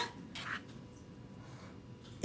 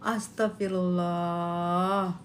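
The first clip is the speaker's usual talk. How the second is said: slow soft sigh; 9 semitones lower, 8 dB quieter, much slower than usual. Real, not acted.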